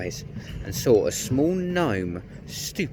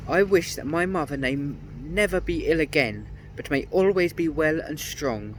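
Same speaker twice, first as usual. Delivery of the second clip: firm wish; 7 semitones higher than usual. A narrator's reading. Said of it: "he said firmly".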